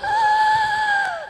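very high, loud wailing gasp; imitated sobbing gasp. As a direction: imitate a boy too upset to talk. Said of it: "so sobbing, sobbing".

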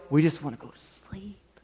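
whispered, worn-out murmur; tired whisper. Retold or imitated. Tired dad whispering to the crowd, worn out. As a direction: breathe it like a drained parent. Exhausted parent, late-night errand.

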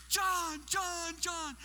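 high, eager repeated hail; excited calling out. Acted. Host running over in the grocery store, excited calling out. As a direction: call a friend's name as you run up.

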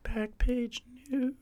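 high, soft, drawn-out jingle voice; experimental silly voice. Pretend. Trying a new segment intro on co-host, high slow voice. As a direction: try a slow, high little voice. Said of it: "I was trying it out, trying something new".

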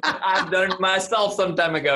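talking through laughter; laughing. Real, not acted. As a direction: laugh while saying it. Laughing at the joke.

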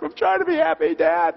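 high-pitched mock kid protest; complaining kid. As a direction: imitate a child whining to Dad. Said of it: "they're complaining in the back seat".

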